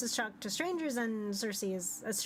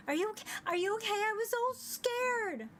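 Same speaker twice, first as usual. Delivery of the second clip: put-on much higher, anxious girl voice; Cora's worried voice; 11 semitones higher than usual. Cora hugs the player after their vanishing, high and anxious. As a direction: rush in breathless with worry.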